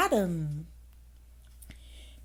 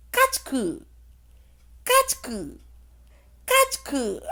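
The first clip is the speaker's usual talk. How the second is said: sneezes; 11 semitones higher, 4 dB louder than usual. A narrator's reading. Peter sneezes in story.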